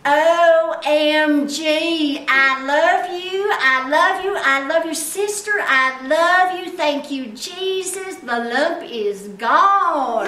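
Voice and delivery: loud, high, stretched-out Texan holler; ecstatic Texas accent. Retold or imitated. Texas woman's voice message on being healed, ecstatic Texas accent. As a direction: whoop it out slowly in a big Texas drawl. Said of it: "so she sounds like this".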